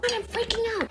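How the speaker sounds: high, hushed panic; panicked whisper. Acted. Wife to husband in the whisper fight, panicked. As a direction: whisper that you're freaking out. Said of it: "this little whisper fight".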